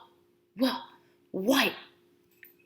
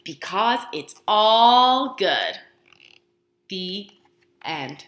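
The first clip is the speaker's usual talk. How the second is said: slow punchline; 4 semitones lower, 3 dB louder, much slower than usual. Acted. Moral punchline, slowed.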